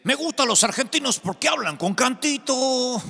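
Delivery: mock Mexican accent, pitched high and singsong; imitating a Mexican. A Mexican man teases the Argentine pastor, in mock Mexican accent. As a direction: play the Mexican man teasing with his own lilt. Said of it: "se me acercó un mexicano y me dice".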